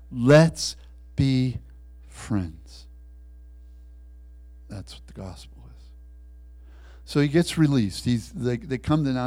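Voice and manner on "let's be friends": firm interruption. Acted. Christ cuts off the sinner's excuses, firm and slow. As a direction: cut in firmly, then let the last word fall.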